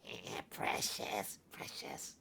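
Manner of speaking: slow, whispered creature hiss of precious; Golem voice. Retold or imitated. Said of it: "like, Golem".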